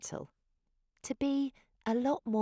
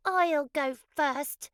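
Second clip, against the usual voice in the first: higher, louder, slow insistent claim; bossy little dinosaur; 8 semitones higher, 5 dB louder, slower than usual. A narrator's reading. Squabbling dinosaur claiming the first turn, loud and insistent. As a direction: demand to go first. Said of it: "said one of them".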